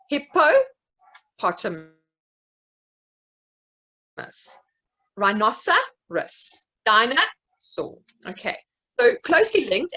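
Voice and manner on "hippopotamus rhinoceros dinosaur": Hippopotamus, Rhinoceros, Dinosaur sounded out syllable by syllable; split into syllables. Shown as a demonstration. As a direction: break each word into its parts slowly. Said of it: "tell me what word I'm trying to say".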